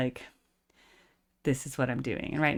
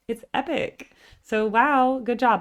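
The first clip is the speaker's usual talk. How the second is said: impressed praise; 8 semitones higher, 6 dB louder, slower than usual. Real, not acted. Praising the singer.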